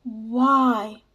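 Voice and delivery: restrained shout, drawn out. A narrator's reading. Young man shouting upward after gulping his wine, mild shout. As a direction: cry it out upward, only mildly loud. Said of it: "shouted out loud".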